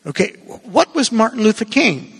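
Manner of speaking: ordinary talk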